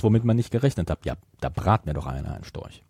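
ordinary delivery

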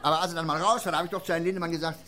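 normal talking voice

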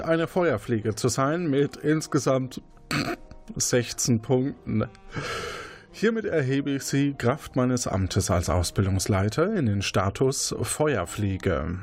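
ordinary delivery

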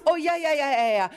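high, flustered yes-yes; swamped by excited kids all talking at once; overwhelmed. Acted. Anja overwhelmed by the children's jumbled stories.

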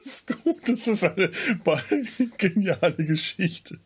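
high laughing words. Real, not acted. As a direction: speak through helpless giggles. Still laughing at the plot.

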